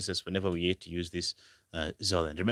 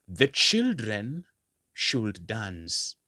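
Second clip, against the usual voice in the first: put-on The children drawn out slowly and raised, should dance dropping; slow model sentence; 2 semitones higher, much slower than usual. Shown as a demonstration. Teacher slowly modelling the example for the class. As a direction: lay out the English sentence slowly. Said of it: "You want to say".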